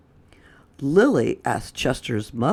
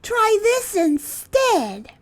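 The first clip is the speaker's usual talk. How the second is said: very high, slow character voice; Lily's voice; slower than usual. Acted. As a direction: offer it up high and slowly. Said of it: "said Lily".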